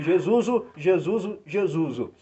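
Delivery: JESUSO with an added final O, loud and high; loud repeated "Jesuso", easing down at the end; imitated congregation repeating. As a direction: echo "Jesuso" back like a congregation. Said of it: "Aí a gente repetia".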